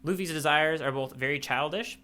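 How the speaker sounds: ordinary talk, nothing marked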